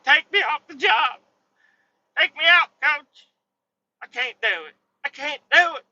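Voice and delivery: high, loud, whiny begging; exaggerated retold pleading; mock crying. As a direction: beg the coach in a hammed-up tearful wail. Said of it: "Okay, I'm crying".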